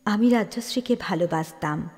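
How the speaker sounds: ordinary delivery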